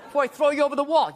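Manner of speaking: hammed-up angry warning; mock scary threat. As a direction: threaten the kid just to scare them. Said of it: "you scare 'em a little bit".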